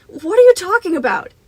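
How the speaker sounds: loud, high-pitched frightened cry; panicked outburst. A narrator's reading. Boy confronts Moon in a loud, high panic. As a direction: cry it out in desperate disbelief. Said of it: "snapping out of the stunned silence".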